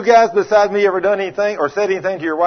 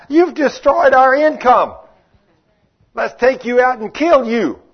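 higher, louder, slow outraged shout; angry crowd; 8 semitones higher, 4 dB louder, slower than usual. Retold or imitated. Angry masters who lost their income, outraged shout. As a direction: accuse him with outrage. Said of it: "They said".

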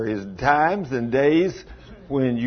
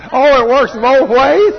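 high and louder, slow amused exclaim; Oh, it works both ways, with raised voice; excited exclaim; 10 semitones higher, 7 dB louder than usual. Real, not acted. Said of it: "I go".

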